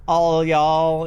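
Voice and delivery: slow Southern drawl on "All y'all"; Southern phrase. Acted. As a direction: stretch it out in a Southern accent. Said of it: "is one of 'em on here".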